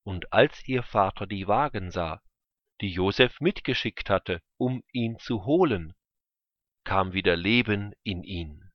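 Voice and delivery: ordinary delivery, nothing marked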